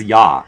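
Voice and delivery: "yeah" as an open "ya", falling; imitating Wakka. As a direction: quote his "yeah" as a drawn "ya". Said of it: "I like the way he says".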